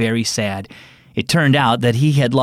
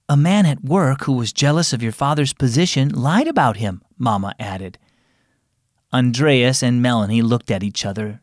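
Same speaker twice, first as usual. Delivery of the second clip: gently voiced children's story reading, lightly acting the family; story narration; 4 semitones lower than usual. A narrator's reading. Host reading a family story to kids, lightly voiced narration. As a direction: tell it like a bedtime story, giving each character a hint of their own voice.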